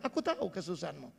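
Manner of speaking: very soft and low, quickly spoken comfort; God's gentle reassurance. Retold or imitated. Preacher repeating God's promise to the congregation, hushed and tender. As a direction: hush it like a secret promise. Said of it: "Hari ini Bapak Ibu dengar".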